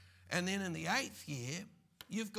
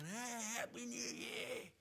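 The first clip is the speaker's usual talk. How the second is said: mock cheery greeting; 6 semitones higher, 5 dB quieter than usual. Preacher imitating the congregation at New Year, mock cheery. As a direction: lightly mimic festive cheer. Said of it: "there was some of you going".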